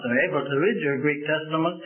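ordinary talk

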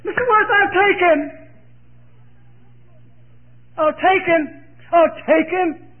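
put-on high, loud, tearful old woman's surrender; Maggie giving in. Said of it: "You know what she said?".

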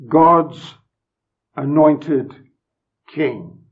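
God's, Anointed, King each set apart by a pause and hit; emphasis on each word. Shown as a demonstration. Preacher giving the psalm's title, weighing each word. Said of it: "with emphasis on each word".

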